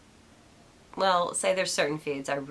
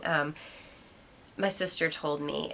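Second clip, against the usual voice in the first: ordinary talk, nothing marked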